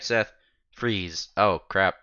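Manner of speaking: ordinary delivery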